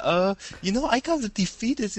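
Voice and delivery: put-on squeaky anime girl voice, slowed down; high girly voice. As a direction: play a timid anime girl. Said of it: "She's like".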